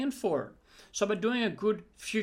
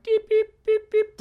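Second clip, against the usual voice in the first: high, loud beep-beep like a timer; 9 dB louder than usual. Retold or imitated. Coach imitating the meditation timer going off, high and loud. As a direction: chirp out an alarm.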